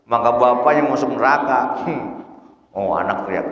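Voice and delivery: ordinary talk, nothing marked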